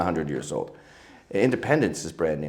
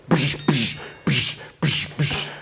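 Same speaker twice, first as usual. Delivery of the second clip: sharp repeated "psh" bursts; imitated thump noises; 4 semitones higher, slower than usual. Showing what greeted the posh newcomer, punchy "psh" noises. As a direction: make short, noisy mouth-made hits. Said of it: "And it was just like".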